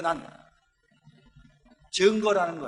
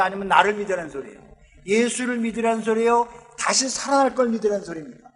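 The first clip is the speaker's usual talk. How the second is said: ordinary delivery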